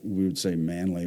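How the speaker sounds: ordinary talk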